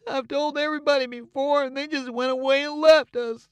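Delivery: high, loud teary complaint; blubbering. A narrator's reading. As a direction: sob it out like a forsaken boy. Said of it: "a blubbering".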